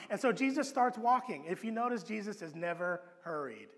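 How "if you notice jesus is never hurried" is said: ordinary talk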